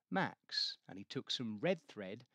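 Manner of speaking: ordinary delivery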